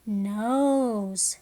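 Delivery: "nose" drawn out; phonics-teacher "nose" with a stretched vowel; long O in nose. Shown as a demonstration. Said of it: "words that have the long sound".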